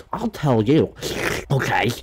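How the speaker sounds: put-on scolding impression; mock accuser. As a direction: exaggerate an offended accusation. Imitates the finger-pointing tail wearer.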